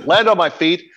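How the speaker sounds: normal talking voice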